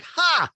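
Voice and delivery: loud, high single "Ha!"; Kyle. Retold or imitated. Housewife scoffing at her husband, loud high mimicked laugh. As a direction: bark out one mock laugh. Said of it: "And Kyle's like".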